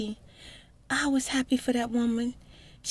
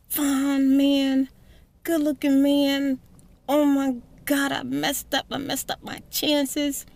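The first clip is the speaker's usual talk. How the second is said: higher, louder self-scolding; loud lament; 6 semitones higher, 4 dB louder, slower than usual. Retold or imitated. Driving home, scolding herself loudly over the lost man. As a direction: wail over the good man you lost.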